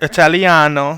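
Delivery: imitating her rolled Italiano; Italian accent. Shown as a demonstration. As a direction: repeat Italiano back with a hammed-up Italian lilt. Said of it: "That's what you said".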